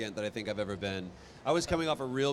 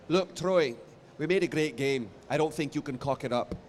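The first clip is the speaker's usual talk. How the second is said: put-on terrible Scottish accent; 3 semitones higher than usual. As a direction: lightly voice the Scottish director, encouraging. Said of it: "I'm gonna do a terrible Scottish accent".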